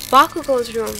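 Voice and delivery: loud frantic shout. A narrator's reading. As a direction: call out the room in a panic. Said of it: "Kirishima shouts".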